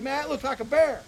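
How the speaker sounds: half-suspicious, squinting at it; doubtful driver. Retold or imitated.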